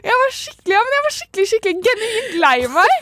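high, tearful voice breaking into laughter; starting to cry. Real, not acted. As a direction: push the story out through rising tears. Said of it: "Du begynner å gråte".

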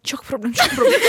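laughing imitation of the beautician; laughing mock. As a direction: throw it back in mock. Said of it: "bare være sånn".

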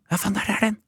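mock excited find, kept under the breath; talking to yourself in the car, imitated; imitating mother muttering to herself. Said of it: "hører jeg hun snakke til seg selv".

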